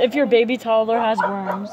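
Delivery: reading aloud. Real, not acted. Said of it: "It says".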